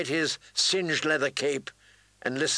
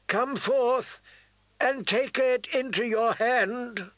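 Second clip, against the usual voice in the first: carrying invocation; 9 semitones higher, slower than usual. A narrator's reading. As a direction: call it out over a gathered army. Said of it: "She lifted her voice so it carried out".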